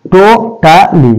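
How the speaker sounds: Totally in three slow beats, টো, টা, লি; short clear gaps inside totally; syllable by syllable. Shown as a demonstration. Teacher counting three syllables, totally divided. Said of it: "এখানে দেখো তিনটে syllable".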